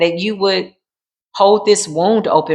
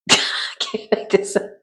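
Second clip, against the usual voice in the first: whispered; 5 dB quieter than usual. Real, not acted. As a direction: whisper "can't make this up" softly after a laugh. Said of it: "I cannot make this up".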